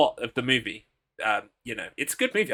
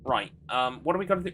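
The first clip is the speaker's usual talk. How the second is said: usual speaking voice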